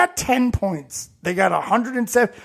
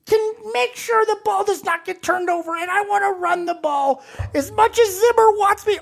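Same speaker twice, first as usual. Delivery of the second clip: wrestling promo; 9 semitones higher than usual. Pretend. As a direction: cut a promo like a hyped wrestler. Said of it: "mix with a wrestling promo".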